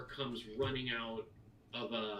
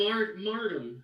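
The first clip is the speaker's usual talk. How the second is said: put-on shy child; 8 semitones higher, slower than usual. Boy gives his name.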